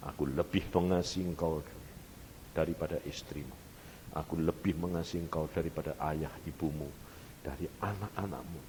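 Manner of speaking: low, softened, intimate assurance; tender divine voice. Retold or imitated. God assures the preacher of greater love, tenderly. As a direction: speak gently, as God reassuring a child. God's loving words to preacher.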